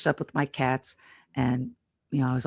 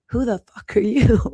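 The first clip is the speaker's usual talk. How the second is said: mock angry patient; 7 semitones higher, 4 dB louder than usual. Confused patient retold, cursing.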